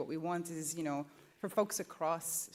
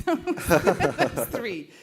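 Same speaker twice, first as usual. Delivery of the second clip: laughing; 14 dB louder than usual. Real, not acted. Laughing about her face on slide.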